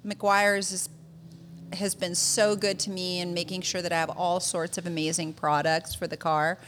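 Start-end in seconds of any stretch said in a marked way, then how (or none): none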